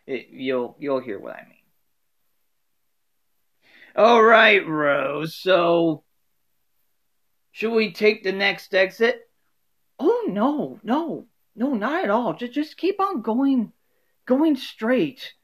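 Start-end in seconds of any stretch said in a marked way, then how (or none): 3.9-9.3 a louder, higher nod to Dorothy; a light Dorothy read asking Rose about the exit; Dorothy asking Rose about the exit, lightly imitated; Dorothy inflection. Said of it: "inflections of each of the characters". 10.0-15.3 much higher, airy Rose voice; a sweet, cheerful high Rose; Rose inflection. Acted. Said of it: "inflections of each of the characters".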